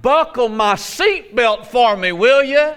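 high, loud, ready-to-go demand; eager grandmother. Acted. Preacher as grandmother ready for heaven, eager high-pitched demand. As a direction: play an unafraid grandma, raring to leave. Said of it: "She's like".

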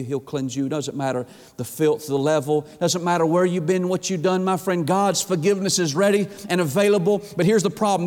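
ordinary delivery

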